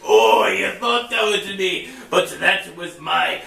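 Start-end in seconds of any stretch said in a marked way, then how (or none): none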